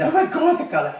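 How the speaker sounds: high, loud laughing alarm; mock panic. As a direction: hairdresser freaking over the colour. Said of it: "if I was going".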